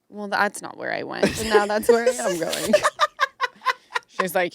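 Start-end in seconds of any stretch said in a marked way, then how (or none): 2.8-3.7 high squealing laugh. Real, not acted. High laugh at own story.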